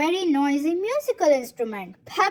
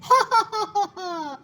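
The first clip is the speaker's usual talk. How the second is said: laughing; 4 semitones higher, much faster than usual. A narrator's reading. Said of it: "laughed Toddy".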